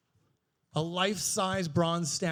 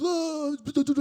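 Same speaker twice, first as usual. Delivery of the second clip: high, loud dopey babbling; mock a man blurting everything; gullible babbler; 8 semitones higher, 5 dB louder than usual. Man telling his girlfriend everything, high mock babble.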